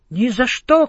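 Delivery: high, firm little shout; shouted refusal. A narrator's reading. Ants refuse to follow Egor home, high shout. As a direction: refuse loudly. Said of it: "кричат мурашки".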